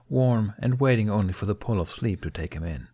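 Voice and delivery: ordinary delivery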